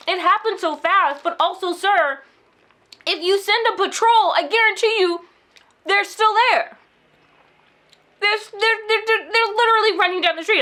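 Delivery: high, loud pleading at the policeman; retold shouting. To the police on the phone, urgent high loud pleading. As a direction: push your voice up, insistent. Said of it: "shouting at this policeman".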